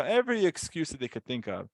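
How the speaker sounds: ordinary talk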